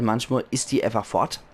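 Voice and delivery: normal talking voice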